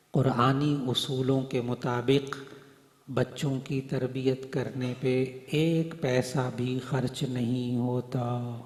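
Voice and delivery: slow, weighty delivery; slow emphasis. Real, not acted. Preacher stresses to parents that upbringing costs nothing, slow and weighty. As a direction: lay down each word slowly.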